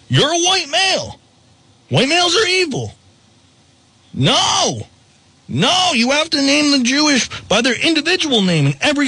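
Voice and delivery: high, nasal mock scold; mocking shrill voice. Mock quote of critics.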